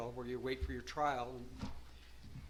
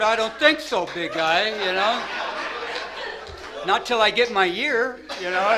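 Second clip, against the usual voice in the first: loud refusal; 6 semitones higher, 14 dB louder than usual. Retold or imitated. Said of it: "I said".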